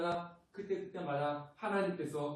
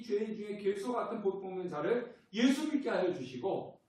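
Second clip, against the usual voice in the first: preach; 5 semitones higher, 4 dB louder than usual. Acted. Preaching at full force.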